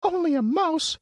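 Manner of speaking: very high, offended squeak; indignant squeaky mouse. Acted. Mouse queen offended by the Woodman, indignant squeak. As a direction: bristle at being called only a mouse. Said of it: "cried the little animal indignantly".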